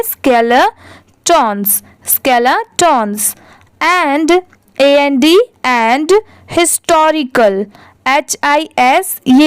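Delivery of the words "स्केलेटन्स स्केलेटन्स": "स्केलेटन्स" slowly split as skela-tons; slow model pronunciation. Shown as a demonstration. Teacher showing learners how to read it, slow split "स्केलेटन्स". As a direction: pause inside "स्केलेटन्स", drawing out both parts. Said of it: "इसको हम लोग क्या पढ़ेंगे?".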